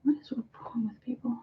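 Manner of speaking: imitating viewers in a soft, hushed whisper; mocking viewers' scandalised whisper. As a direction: hush it like a shocked onlooker. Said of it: "Y'all be like".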